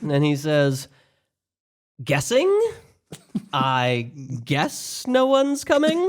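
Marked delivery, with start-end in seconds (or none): 2.0-6.1 blind man's punchline. Retold or imitated.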